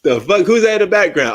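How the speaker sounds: sudden high, loud startled question. Real, not acted. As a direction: jolt up in surprise at the screen. Squinting at blurry TV background.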